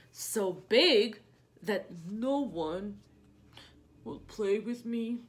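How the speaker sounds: slow, a touch of a lonely giant; lightly voiced sad whale. Reading to kids, whale lonely because he is big. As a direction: let the sadness sink in slowly.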